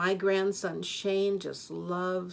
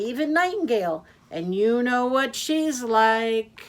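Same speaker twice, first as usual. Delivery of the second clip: high, loud, fast-talking taxi driver; a cheerful blabbermouth who never stops; loud chatty Yak; 4 semitones higher, 7 dB louder, faster than usual. Acted. Yak the taxi driver chattering to his passenger, loud and high.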